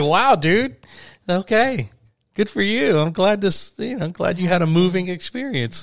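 high-pitched, humoring a tearful friend; retold bemused support, pitched well up; humoring reply. Replying to friend moved by factory tour, humoring him.

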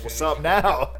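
mock taunt. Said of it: "I said".

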